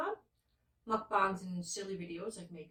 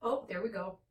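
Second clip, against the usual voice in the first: usual speaking voice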